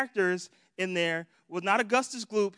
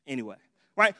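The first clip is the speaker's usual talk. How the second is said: usual speaking voice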